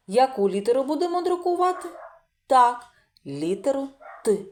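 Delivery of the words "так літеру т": normal talking voice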